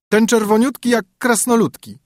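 high, teasing sing-song rhyme; a haughty princess mocking a fat knight in verse; princess mocking a suitor. A narrator's reading. Princess sneering at a suitor, teasing and high.

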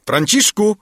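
a high, loud commanding yell of the name; a loud call that falls at the end; stern shout. A narrator's reading. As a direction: bellow the name down sharply to summon the servant. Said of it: "krzyknął głosem surowym i groźnym".

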